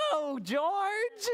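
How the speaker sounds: high, drawn-out storybook mother; correcting mother. Acted. Mother dog correcting George's oink, high exasperated voice. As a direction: scold the pup in a high, playful voice. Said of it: "said George's mother".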